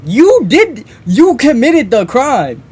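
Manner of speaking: high, loud disbelief; incredulous outburst. Real, not acted. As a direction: throw the blame back, loud and emphatic. Outraged retort to the killer's excuse.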